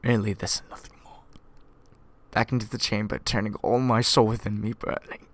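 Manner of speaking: ordinary delivery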